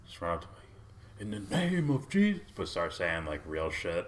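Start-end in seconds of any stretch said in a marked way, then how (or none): 1.2-2.4 high, loud preacher's cry; zealous reverend. Retold or imitated. Mocking street reverends to the co-host, high preacher's proclamation. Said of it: "Or reverends or whatever".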